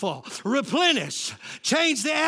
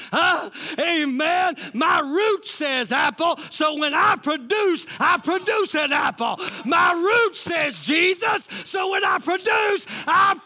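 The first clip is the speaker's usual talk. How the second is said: raised, emphatic preacher's shout; full-force preaching; 4 semitones higher than usual. Real, not acted. Preacher telling congregation what their root produces, full-force preaching. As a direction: drive each phrase home to the congregation.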